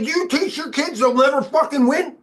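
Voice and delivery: retold angry yell, high and loud. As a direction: blast the question at him in disbelief. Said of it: "I yelled at Michael Tamangalo".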